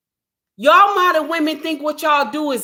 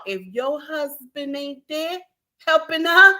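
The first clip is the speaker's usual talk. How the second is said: ordinary delivery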